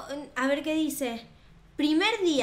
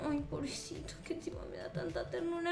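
whisper; 12 dB quieter than usual. Real, not acted. Tender aside about zombie.

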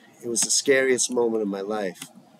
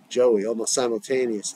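ordinary delivery

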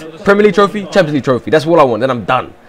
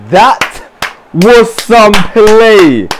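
very slow, high, drawn-out marvel; loud stunned praise; awed; 5 semitones higher, 5 dB louder, much slower than usual. Real, not acted. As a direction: stretch each word in amazement. Awe at a great move.

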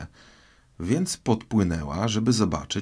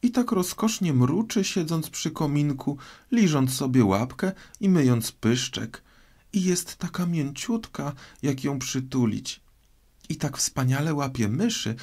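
put-on high, fond girlish chatter; light, high-pitched girl reminiscing; doting Alice; 6 semitones higher than usual. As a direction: gush dreamily about a beloved pet.

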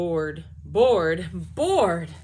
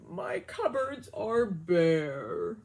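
sad bear; 3 semitones lower, 4 dB louder, much slower than usual. Acted. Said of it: "Bear sighs and he moans".

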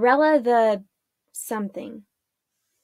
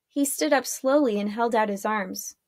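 plain reading voice

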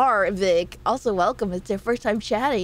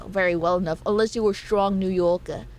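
ordinary talk, nothing marked

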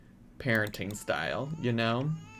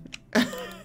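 high squeal of a laugh. Real, not acted.

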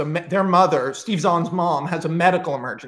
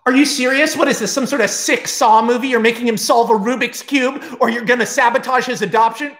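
louder and higher, in disbelief; incredulous outburst; 6 semitones higher, 3 dB louder than usual. Acted. The girlfriend calling out the host parents, incredulous. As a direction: fire off the objection, outraged. Girlfriend indignant at the puzzle demand.

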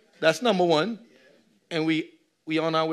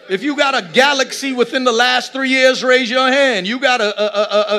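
rising, loud pulpit call-out; preaching at full force; 6 semitones higher, 10 dB louder than usual. Acted. Pastor teasing his congregation, preaching loud. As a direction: preach it hard at the pews.

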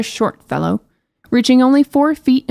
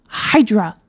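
annoyed shout. Acted. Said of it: "the princess screamed in annoyance".

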